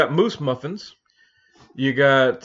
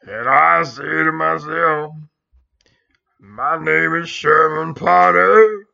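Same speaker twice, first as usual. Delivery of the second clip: [name] impression; slower than usual. Friends joking about Potter, slow mock [name] voice. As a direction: drag it out like a hammed-up [name]. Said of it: "[name] and Sherman Potter".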